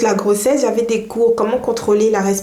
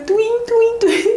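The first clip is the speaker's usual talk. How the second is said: high-pitched little "twing" noises; imitated pain twinges; 12 semitones higher, slower than usual. Mother shows viewers how contractions first felt, high imitated twinges. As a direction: voice the twinges as high, springy sounds.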